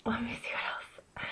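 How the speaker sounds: soft whispered musing; whisper to self. Real, not acted. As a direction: breathe it to yourself while thinking. Thinking aloud to herself.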